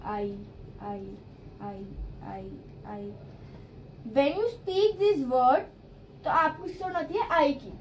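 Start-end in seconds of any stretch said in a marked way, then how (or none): 0.0-3.1 आई repeated, fading softer each time; sound demo. Shown as a demonstration. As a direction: drill आई as the vowel, trailing off. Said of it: "इसमें आई की sound आ रही है।". (आई की आवाज़ दोहराते हुए, धीमी होती हुई)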